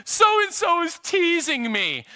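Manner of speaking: put-on a child calling out to a parent; tattling kid. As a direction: whine it like a kid telling on a sibling. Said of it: "they're like".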